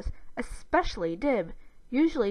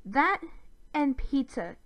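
snippy retort; 5 semitones higher, slower than usual. A narrator's reading. Sister answering her brother's accusation, snippily. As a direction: toss back a curt comeback. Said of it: "Gaz hissed back".

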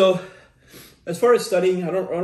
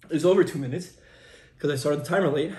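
ordinary talk, nothing marked

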